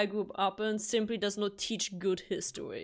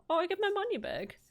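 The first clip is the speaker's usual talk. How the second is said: surprised delight; 11 semitones higher, faster than usual. Real, not acted. Game refunds her money.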